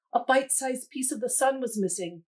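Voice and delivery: ordinary delivery, nothing marked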